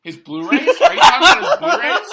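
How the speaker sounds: high, loud incredulous question with a laugh; shocked disbelief. Retold or imitated. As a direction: squeal it out in disbelief. Retold police stop, disbelief.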